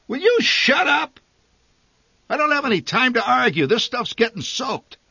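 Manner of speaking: high, loud, then rushed and curt; furious father. A narrator's reading. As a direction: shout her down, no time to argue. Father cuts mother off.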